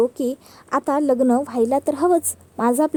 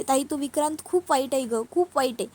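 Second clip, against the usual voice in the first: usual speaking voice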